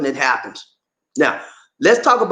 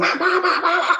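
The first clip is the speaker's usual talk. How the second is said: mock scream; 13 semitones higher, faster than usual. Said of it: "I scream".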